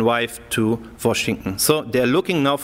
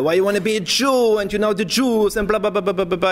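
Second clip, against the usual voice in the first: mock cursing rant; 6 semitones higher than usual. German mother cursing her daughter on the phone, imitated angry rant. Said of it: "she started to curse her".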